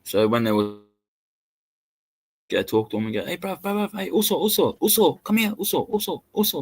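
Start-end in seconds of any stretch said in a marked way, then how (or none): 3.2-6.6 higher, slangy Islander street voice; calling the bouncer over. Retold or imitated. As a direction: wave him over with a singsong "also".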